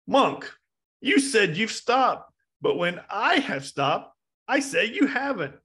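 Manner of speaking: high, emphatic acted demand; slow, insistent protest pitched well up; exasperated challenge. Bandit challenging the monk over having stopped, exasperated.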